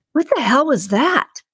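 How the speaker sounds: high, startled cry of pain. A narrator's reading. Randy stabbed with a needle, crying out in shock. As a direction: yelp at the sudden jab. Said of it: "Randy cried out".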